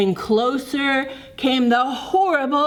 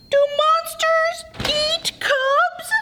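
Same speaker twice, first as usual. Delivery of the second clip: much higher small frightened cub; whimper. Acted. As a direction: whimper the question like a scared cub. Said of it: "whimpered Wolf".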